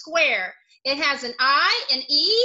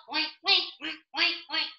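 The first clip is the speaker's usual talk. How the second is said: pig oinks; 3 dB quieter, faster than usual. Pretend. Teacher imitating a pig.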